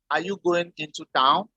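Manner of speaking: ordinary talk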